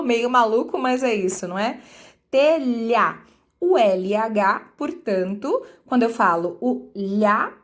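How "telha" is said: "Telha" said loud with a pronounced LH; demonstrating telha. Shown as a demonstration. Teacher repeating telha to show the sound. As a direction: lean into the LH in "Telha". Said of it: "parece que é esse o som".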